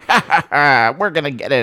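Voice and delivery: lightly hammed-up evil laugh into a gloat; mock villain, charmingly evil; mustache-twirling villain. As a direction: play at a cartoon mustache-twirler. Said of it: "he would be twirling it".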